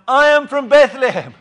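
loud, high proclamation; crying out. Pretend. Jesus imagined crying out to the crowd, loud. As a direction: call it out for all to hear. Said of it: "why didn't Jesus cry out".

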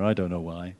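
ordinary talk

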